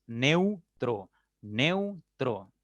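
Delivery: "Neutro" divided neu, tro; syllable by syllable. Shown as a demonstration. Teacher counting two syllables, "Neutro" deliberately split. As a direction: stretch neu, then place tro apart in "Neutro". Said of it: "Una palabra de dos sílabas".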